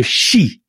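"Xi" softened with a smile; smiling Xi. Shown as a demonstration. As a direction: smile and stretch the sh in "Xi". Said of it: "you just go".

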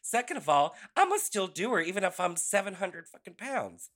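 indignant retort. Retold or imitated. Retorts to rude message about career.

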